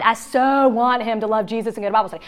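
ordinary talk, nothing marked

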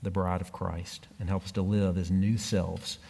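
measured, earnest prayer voice; closing prayer. Acted. Pastor praying over the congregation at the end, earnest and measured.

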